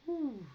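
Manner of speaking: high exhaled "whew"; relieved, high-pitched whew; emotional whew. Real, not acted. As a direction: blow out a breath to hold back tears. Nearly crying on camera over mom.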